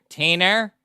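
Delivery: put-on New York accent. Professor from New York calling a student: loud "Tina" with an added r. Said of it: "she would say".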